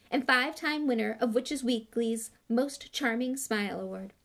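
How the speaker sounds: high, declaiming recital of a card; reading out loud. A narrator's reading. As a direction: announce each grand title in turn. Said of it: "and read out loud".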